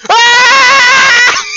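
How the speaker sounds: piercing, sustained "aah" scream; loud high-pitched death scream; long high scream. Real, not acted. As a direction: scream loudly as you get killed. Killed in the game.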